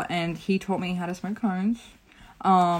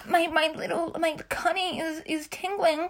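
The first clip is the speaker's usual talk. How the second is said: put-on breathy aroused girly voice; 12 semitones higher than usual. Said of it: "Like that".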